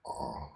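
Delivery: very low, faint "uh"; weak attempt at sticking the tongue out; abnormal tongue-out sound. Shown as a demonstration. Instructor showing abnormal tongue protrusion, faint low "uh". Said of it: "abnormal would be like".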